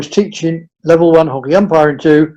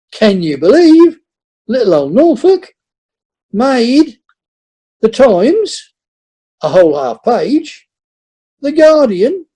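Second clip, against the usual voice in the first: excited; 6 semitones higher than usual. Real, not acted. Proudly recounting press coverage.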